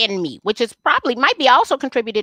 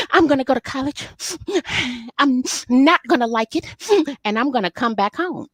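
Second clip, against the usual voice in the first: higher, mock-tearful teen promise; sniffling teen; 6 semitones higher than usual. Daughter sniffling to her mother about going to college. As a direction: whine it like a sulky crying teenager. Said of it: "I'm still crying, I'm still sniffling".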